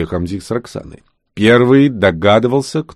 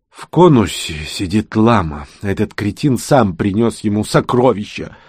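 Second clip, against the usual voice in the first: usual speaking voice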